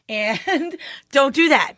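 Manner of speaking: ordinary talk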